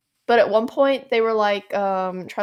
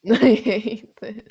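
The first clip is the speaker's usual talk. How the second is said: laughing; slower than usual. Real, not acted. Echoing friend while laughing.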